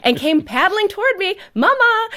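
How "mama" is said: put-on high, loud toddler cry of Mama; child yelling mama. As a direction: call out like a two-year-old bursting in. Toddler running in after crash.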